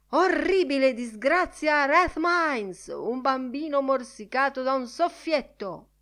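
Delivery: high-pitched headline cry; yelling newsboy. A narrator's reading. Newsboy yelling headlines in Bloom's face, shouted cry. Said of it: "Uno strillone urlò in faccia".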